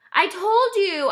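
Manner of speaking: put-on high, insistent kid; a boy digging in his heels; stubborn child. A narrator's reading. Child refusing under table.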